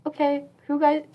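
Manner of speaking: mock teacher-style address to the room; imitating school associate. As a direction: lightly mimic a cheery lunchtime announcement. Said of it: "it's like".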